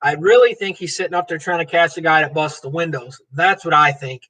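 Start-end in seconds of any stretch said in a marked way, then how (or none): none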